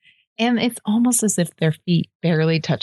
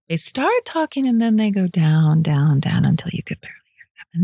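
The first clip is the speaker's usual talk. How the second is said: metal voice descending; 2 semitones lower than usual. Shown as a demonstration. Showing how metal people talk, falling away to near whisper. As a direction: start up, then sink down till breath runs out. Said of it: "the metal voice starts like this".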